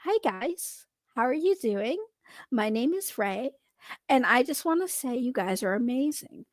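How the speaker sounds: quiet, sweet polite voice; softened pleasant greeting. Shown as a demonstration. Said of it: "Is, is this better?".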